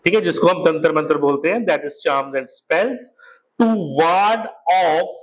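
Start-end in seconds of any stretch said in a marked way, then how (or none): 1.7-5.1 very slow, word by word; teacher dictating, very slow; slow dictation. Shown as a demonstration. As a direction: dictate it slowly for notes.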